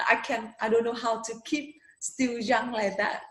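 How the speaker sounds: ordinary delivery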